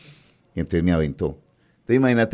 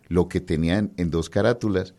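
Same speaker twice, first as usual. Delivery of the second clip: ordinary delivery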